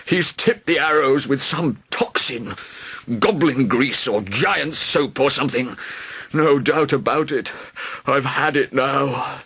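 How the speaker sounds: a hint of a moaning dragon; mild moaning. A narrator's reading. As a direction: complain self-pityingly. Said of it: "the dragon moaned".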